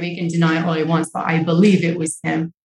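ordinary talk

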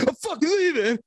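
high, mock yelled exit line; angry brother quote. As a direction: storm out yelling it. Siblings screaming at each other.

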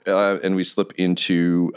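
normal talking voice

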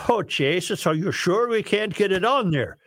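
put-on accidental Irish accent. Sven protesting to the pilot, Norwegian accent gone Irish. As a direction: let the Norwegian drift toward Irish. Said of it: "Now he's Irish".

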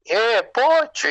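high, slow, stretched-out call of his name; delighted greeting. Retold or imitated. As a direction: greet an old friend with a happy, drawn-out call. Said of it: "nos dimos un abrazo".

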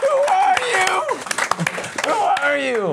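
very high mock squeak; high squeaky voice. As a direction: shriek it out in falsetto. Echoing the squeaky question.

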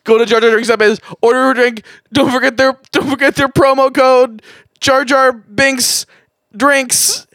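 high-pitched, desperate ad read; frantic pleading. Pretend. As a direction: wail the promo like a hostage begging. Frantic begging to end the ad.

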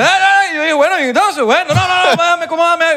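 high, loud flustered stammer; mock panicked teacher caught off guard. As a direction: imitate the teacher squawking in panic. Teacher's retold flustered reply.

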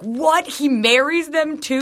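high, drawn-out disbelief; exaggerated slow, high astonishment; retold shock. Retold shocked reaction to montage.

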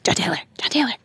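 urgent breathy whisper, said twice over; retold excited whisper; blurted whisper. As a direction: blurt the name under your breath. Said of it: "I immediately blurt out [name]".

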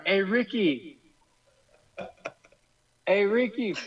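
calling out. Retold or imitated. Acts out calling to Ricky.

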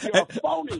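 laughing through the insult, pitch jumping up; the words bubble out with a laugh. Real, not acted. Host laughing as he calls the politician a phony.

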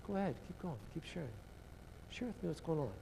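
soft encouragement to a grieving friend; gentle invitation. Retold or imitated. Jesus to the disappointed disciples, soft and gentle.

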